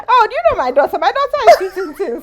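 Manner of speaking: high loud proud mom voice with a laugh; mother proudly introducing her daughter. Retold or imitated. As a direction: show off your daughter proudly. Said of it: "she intro".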